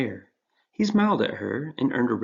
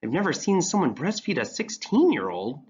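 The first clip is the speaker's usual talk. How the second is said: vigorous retort; 7 semitones higher than usual. Acted. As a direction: push back with extra force. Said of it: "retorted with a bit more vigor".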